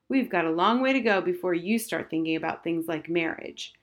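ordinary delivery, nothing marked

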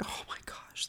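breathy whisper to yourself; whispered relief. Retold or imitated. Defendant hearing first not guilty, whispered relief. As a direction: let it out as a barely voiced whisper.

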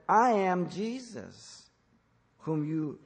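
slow, deliberate I am Jesus, each word weighed; the Lord answering Saul with slow emphasis; emphatic. Retold or imitated. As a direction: lay down I am Jesus slowly and firmly. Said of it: "answered Saul emphatically".

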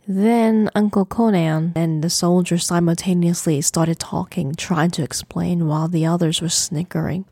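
ordinary delivery, nothing marked

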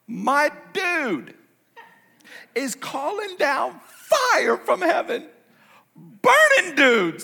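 high, loud, drawn-out proclamation; full-force preaching. Real, not acted. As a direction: build to a slow, booming pulpit cry. Preaches the Elijah story at full force.

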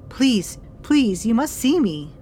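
high, loud pleading; high loud desperate begging; desperate plea. A narrator's reading. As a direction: beg the midwife to see her. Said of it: "she said".

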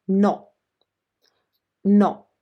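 short, sharp "No"; sharper Italian no. Shown as a demonstration. Teacher shows learners the Italian no, sharper "No". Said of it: "the sound it's a little sharper".

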